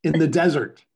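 high, loud, preacherly excitement; excited exclaiming. Real, not acted. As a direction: call the whole crowd to attention, thrilled. Interrupts to exult over guest's story.

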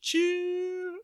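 very high-pitched "choo-choo"; train whistle imitation.

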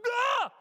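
sudden loud, high yell of fright; hammed-up horror shriek; startled scream. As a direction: scream as if jolted awake by a face. Acts waking to child's face.

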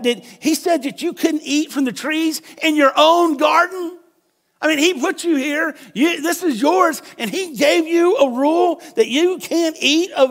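high, incredulous mocking voice; sarcastic disbelief. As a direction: scoff as if it's absurd. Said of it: "what the serpent is really saying".

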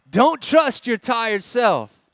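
loud and slow, driven home; drawn-out, forceful preaching line; emphatic slogan. Real, not acted. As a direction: hammer each word to the congregation.

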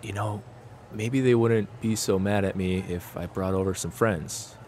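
soft, low musing; thinking to himself. Acted. Doug weighs bringing friends over, softly musing. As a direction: quietly think it through aloud. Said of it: "He's thinking, he's like".

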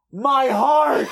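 high, loud mock wail; hammed-up heartbreak. Fan reacts to a tender line, hammed-up heartbreak. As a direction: cry it out as an overcome fan. Acted fan breakdown over scene.

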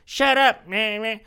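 high, loud mocking imitation of a young gamer; mimicked whiny kid. Kid gamer losing the argument, mocking high yell. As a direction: squawk back like a riled kid.

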